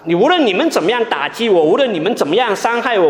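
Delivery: ordinary talk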